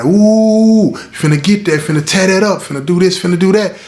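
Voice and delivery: high, loud hooting crowd of brothers; hyped-up crowd teasing. Retold or imitated. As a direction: tease them like a rowdy crowd cheering. Crowd hypes them as they pass.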